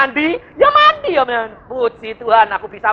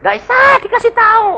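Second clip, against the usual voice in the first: high, louder exasperated quote; quoting Pak Agung; 13 semitones higher, 4 dB louder, faster than usual. Retold or imitated. Preacher quoting Pak Agung on stubborn elders, exasperated. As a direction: complain that the old can't be told. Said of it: "Pak Agung mengatakan gini".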